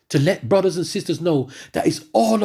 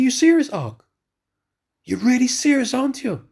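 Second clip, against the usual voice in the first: disbelieving; 7 semitones higher than usual. Retold or imitated. Retold call, he is astonished.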